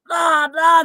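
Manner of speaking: brief mocking shout of noisy critics. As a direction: bark it out like a crowd yelling at you. Mimicking people coming at you.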